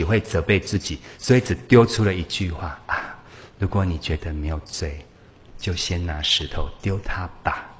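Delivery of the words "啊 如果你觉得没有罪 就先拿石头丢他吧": quietly, slowly, without any accusation; slow calm tone. Retold or imitated. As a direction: let the line land gently and slowly. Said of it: "我猜是这种慢慢的音调啦". (慢慢的、平静的语调，轻声说出)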